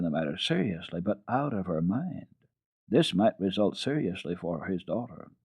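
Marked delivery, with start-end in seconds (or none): none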